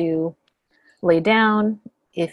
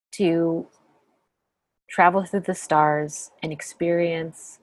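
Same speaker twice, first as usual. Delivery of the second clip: calm meditation guide, long pauses between phrases; unhurried, settled voice leaving lots of silence; guided meditation. Acted. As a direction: guide them gently inward, phrase by phrase.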